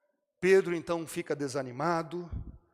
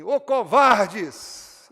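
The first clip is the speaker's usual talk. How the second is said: a loud, slow, scornful shout of contempt; mock harsh rebuke; 7 semitones higher, 17 dB louder, much slower than usual. Preacher imagines Jesus shouting at the disciples, mock harsh rebuke. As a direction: bark it out like the master Jesus says he never was.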